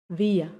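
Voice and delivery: "wir" ending in an open A sound; vocalized final R. Shown as a demonstration. Teacher demonstrating "wir" with final R as A. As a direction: let "wir" glide into A, no R. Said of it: "suena como una A al final".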